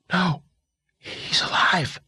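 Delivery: breathy whisper, slow. Acted. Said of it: "then looked up to whisper".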